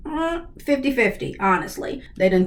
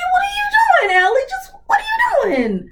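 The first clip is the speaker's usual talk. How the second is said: panicked squeal. Real, not acted. Alarmed at Ellie going down.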